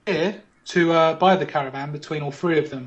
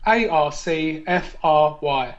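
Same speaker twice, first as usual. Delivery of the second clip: slow spelling; much slower than usual. Shown as a demonstration. Spelling out anagram letters.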